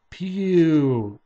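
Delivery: drawn-out exclamation of disgust. A narrator's reading. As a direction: recoil from a stink, stretching it out. All disgusted at unwashed shorts.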